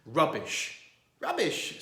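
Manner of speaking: sputtering in outrage; spluttering. Acted. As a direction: splutter it angrily. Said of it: "spluttered Mister Curry".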